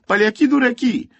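put-on very high, loud childish insult; crying out. A narrator's reading. Boy answers the soldier who hushed him, high-pitched shout. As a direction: shriek the insult like a small boy. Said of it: "wykrzyknął Wojtuś".

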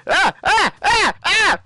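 short, high repeated yelps; panicked yelps. Pretend. Player flees a creeper bomb, panicked yelps. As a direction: yelp in quick bursts of game panic.

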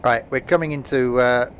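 usual speaking voice

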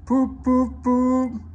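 loud high poop poop poop; loud, high whistle toots; engine whistle. A narrator's reading. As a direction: toot like a steam whistle.

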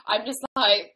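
normal talking voice

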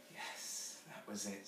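put-on hushed, breath-filled child's agreement; big breath. A narrator's reading. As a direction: let it out softly on a big breath. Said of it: "says Jane with a big breath".

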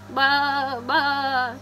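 loud, drawn-out bleat; sheep's baa. Acted. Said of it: "said the fat red hen".

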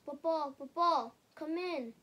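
put-on children calling out loud; shouting. A narrator's reading. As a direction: call him in loudly. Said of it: "They sh-, shouted".

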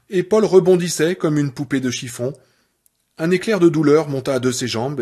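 plain reading voice

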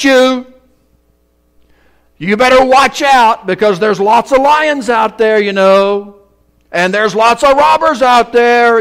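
a loud, hammed-up threatening God; mock threatening God. Preacher mocking a vengeful God, hammed-up threat. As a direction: play the God who won't say this, scolding and menacing. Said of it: "God doesn't say from the top".

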